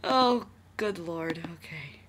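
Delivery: slow whispered exasperation; exasperated. Real, not acted. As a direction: sigh it out in disbelief. Exasperated aside.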